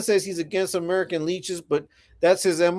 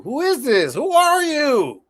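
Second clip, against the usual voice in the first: rising to a loud, drawn-out demand; 8 semitones higher, 4 dB louder, slower than usual. Real, not acted. Streamer challenging an unknown commenter, loud demanding. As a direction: stretch it out, high and loud, insisting on an answer.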